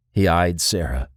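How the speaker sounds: plain reading voice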